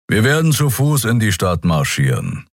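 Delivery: ordinary delivery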